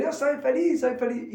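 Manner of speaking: high, bright declaration of joy; cheerful declaration. Shown as a demonstration. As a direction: say it like you mean to feel happy.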